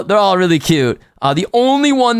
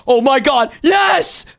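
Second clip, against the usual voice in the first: elated cry jumping much higher; real excited shout; 6 semitones higher, slower than usual. Real, not acted. Streamer glimpses his favorite in the blind box, thrilled shout. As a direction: burst out in high-pitched triumph. Sees the wanted figure early.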